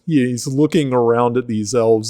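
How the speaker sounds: ordinary delivery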